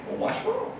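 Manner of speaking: lightly voiced curious patient; imitating the customer. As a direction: ask it up high like a nosy stranger. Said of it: "I can just hear him".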